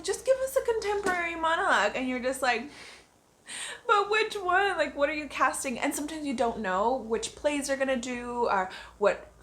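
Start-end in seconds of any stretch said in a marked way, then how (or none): 0.0-1.9 high, quick mock request; imitated audition caller. Actress imitating casting people asking for a monologue, high mock request. 3.9-5.8 high-pitched, questioning comeback; mock bafflement. Actress voicing her reply to casting people, mock bafflement.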